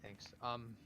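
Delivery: soft, halting plea; hushed, tentative in-character voice; subdued, hesitant character. Acted. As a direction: ask quietly, as if unsure you should. Hesitant character asking for help.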